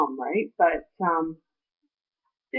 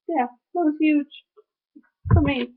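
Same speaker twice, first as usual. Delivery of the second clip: high, tear-choked voice; halting through real tears; real, emotional; 7 semitones higher than usual. Real, not acted. Telling viewers it was huge for her, tearfully emotional.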